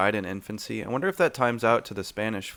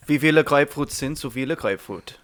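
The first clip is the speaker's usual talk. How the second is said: German phrase; 4 semitones higher, 4 dB louder than usual. Shown as a demonstration. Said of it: "I know how to say".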